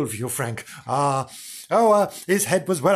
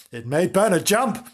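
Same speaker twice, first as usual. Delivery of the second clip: ordinary delivery, nothing marked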